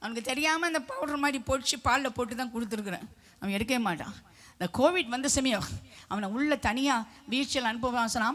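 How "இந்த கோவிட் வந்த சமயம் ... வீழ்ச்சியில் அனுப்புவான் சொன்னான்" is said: ordinary talk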